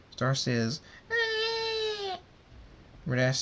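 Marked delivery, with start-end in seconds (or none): 1.1-2.2 put-on very high wailing baby cry; a hungry foal wailing. Baby Star cries for food.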